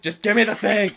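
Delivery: loud, high mock-furious yell; exasperated comic shout; rage quitting. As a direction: explode like a fed-up player. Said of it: "gonna start rage quitting".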